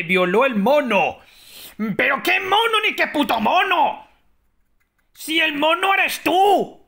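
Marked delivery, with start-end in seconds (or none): 2.0-6.8 outraged shout. Pretend. Outraged reply in role-play.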